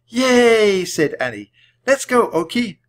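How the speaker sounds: high, loud happy cheer; excited cheer. A narrator's reading. Annie cheering as they run to the castle, high and loud. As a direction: burst out with glee.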